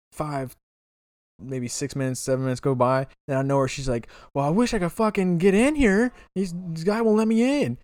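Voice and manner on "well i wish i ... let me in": high, louder indignant woman; complaining woman. Retold or imitated. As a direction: imitate her griping, pitched way up. Retells woman's loud complaint.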